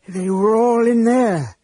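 slow, high, trembling distress. A narrator's reading. Elistan telling of those buried inside, trembling. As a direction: draw it out with a tremble. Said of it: "Elistan said in a trembling voice".

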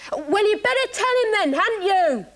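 loud high shout. Pretend. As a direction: call out loudly. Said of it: "no need to shout".

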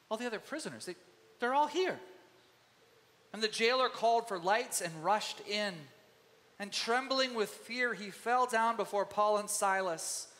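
ordinary talk, nothing marked

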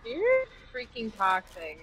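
ordinary delivery

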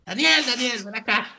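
high-pitched mock call to a friend. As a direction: act out beckoning someone by name. Acting a friend calling him over.

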